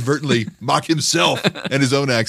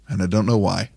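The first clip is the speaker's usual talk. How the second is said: usual speaking voice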